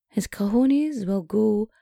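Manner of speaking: slow, drawn-out dramatic line; mock-solemn slow delivery. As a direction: intone it slowly like a grave verdict. Comic solemn pronouncement.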